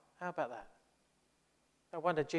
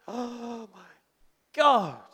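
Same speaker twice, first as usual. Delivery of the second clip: shocked gasp; 8 semitones higher, 8 dB louder, much slower than usual. Real, not acted. Reacting to stories of bad churches.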